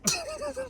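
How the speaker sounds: squeaky high cackle; high laugh. Real, not acted. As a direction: giggle way up high. Laughs at own punchline.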